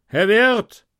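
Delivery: high, loud shout for the innkeeper; soldier calling out. Acted. Dusty rider calls the innkeeper from his horse, loud shout. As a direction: call the landlord from the saddle. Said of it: "vor meinen Gasthof und rief".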